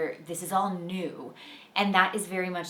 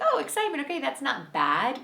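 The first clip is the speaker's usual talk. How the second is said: much higher, bright mock kid discovery; sudden realization; 8 semitones higher than usual. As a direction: let it pop up high like a lightbulb moment. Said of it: "he was like".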